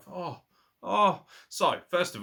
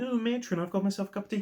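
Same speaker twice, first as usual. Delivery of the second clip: mock posh voice, pitched higher; fancy tea drinker; 8 semitones higher, faster than usual. Mocking fancy tea drinkers in a higher, prissy mock-posh voice. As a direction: ham up a prim tea-sipper. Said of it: "Not a fancy cup of tea".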